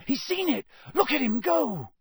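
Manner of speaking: high-pitched thrilled cheering; Harry shouting. A narrator's reading. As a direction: cry out, urging the player on. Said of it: "Harry shouted".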